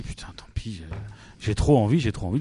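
whispering. Acted. Legionnaire confessing his urge.

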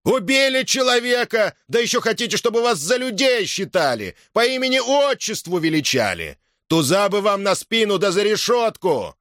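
high, indignant accusing cry; heckling shout. A narrator's reading. Rich villagers accuse the frontline soldiers of murder, shouting. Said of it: "крикнули из толпы богачей".

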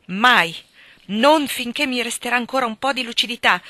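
loud, sharp refusal; firm refusal. A narrator's reading. Helen refuses Hargrave, loud and firm. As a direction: slam the door on him.

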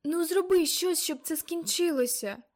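high, loud tearful plea; whimpering plea. Acted. Little Caroline begging, a loud tearful whimper. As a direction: whine it like a scared child. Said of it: "Кароліна жалібно запхинькала".

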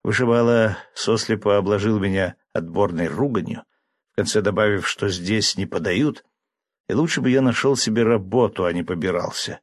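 usual speaking voice

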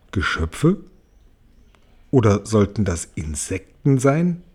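leise, tiefer, zweifelnd nachgefragt; fragend, als traue er seinen Augen nicht; ungläubige Frage. Acted. James wundert sich über die Geschöpfe. (ungläubig und leise gefragt)

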